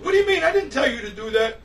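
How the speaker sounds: high, loud alarmed protest; imitating a frantic kid protesting; retold panicked screaming. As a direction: burst out in mock panic. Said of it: "screaming on the top of his lungs".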